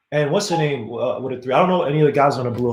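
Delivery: ordinary talk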